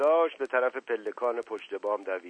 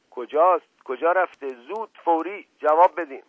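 high, loud, insistent questioning; angry demand; 6 semitones higher, 8 dB louder than usual. A narrator's reading. Husband demanding where the fugitive went, angry and loud. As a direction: fire off the questions, raised and forceful.

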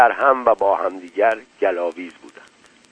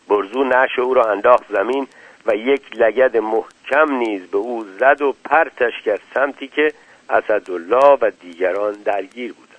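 ordinary delivery

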